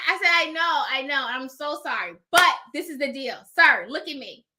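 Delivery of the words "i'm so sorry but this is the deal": ordinary talk, nothing marked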